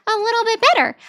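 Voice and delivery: put-on very high, childlike voice all through; high-pitched kid voice. Shown as a demonstration. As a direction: squeak it out like a little kid. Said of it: "actually talk like a kid, be high-pitched".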